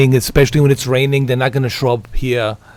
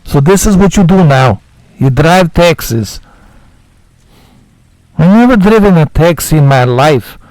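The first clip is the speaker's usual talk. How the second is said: put-on foreign game character, higher and louder; loud, higher put-on voice of a disappointed newcomer; Nico Bellic voice; 4 semitones higher, 4 dB louder than usual. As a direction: play a blunt immigrant hero who is fed up with the city. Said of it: "My Nico Bellic is going away".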